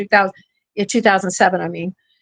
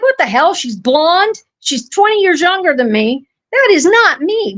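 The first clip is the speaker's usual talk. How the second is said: retold indignation; 6 semitones higher than usual. Retold indignant reaction to impostor.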